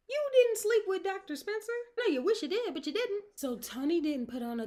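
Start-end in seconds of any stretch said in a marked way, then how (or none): 0.1-3.2 high, loud incredulous retort; mocking disbelief. Host challenging character about Doctor Spencer, mocking. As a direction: taunt her in a raised pitch.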